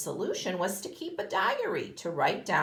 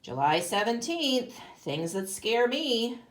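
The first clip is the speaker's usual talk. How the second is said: storybook reading to a class; reading a picture book; 3 semitones lower than usual. Acted. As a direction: read it like a picture book for kids. Teacher reads picture book aloud.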